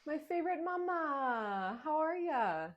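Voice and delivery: high, drawn-out gushy hello; gushing greeting. Acted. Principal fawning over a favorite mother, gushing. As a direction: greet a favorite with stretched, lifted words.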